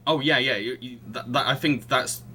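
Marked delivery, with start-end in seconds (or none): none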